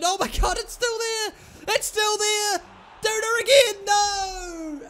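high shouting in disbelief; excited shout. Real, not acted. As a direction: yell as the ball stays out. Shot hits post, shouting.

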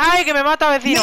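high panicked yelp; panicked cry. Pretend. Being attacked in game.

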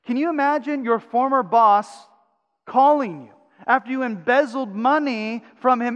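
normal talking voice

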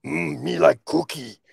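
puppet monster voice craving a snack; put-on Cookie Monster voice. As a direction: do a hungry cookie-loving monster. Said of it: "Cookie Monster".

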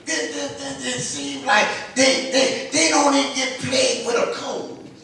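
high-pitched, urgent pulpit delivery; impassioned preaching. Real, not acted.

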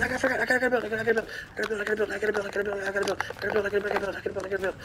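soft, rapid-fire repeated chant; frantic muttering. Real, not acted. Player building under attack, frantic muttering.

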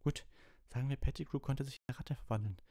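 put-on very high, insistent boy's voice; high-pitched, flustered reasoning; sceptical protest. A narrator's reading. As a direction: argue squeakily against an absurd claim. Ron argues in disbelief.

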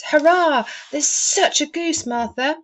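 high, loud cheer, slowing on the goose; excited children's cheer. A narrator's reading. Young Cratchits cheering to Martha about the goose, overjoyed. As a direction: cheer like kids wild about dinner. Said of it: "cried the two young Cratchits".

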